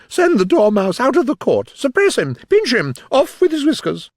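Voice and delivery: high, loud volley of orders; crying out. A narrator's reading. Queen having the Dormouse thrown out, crying out. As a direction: fire off each command at full pitch. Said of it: "the Queen cried out".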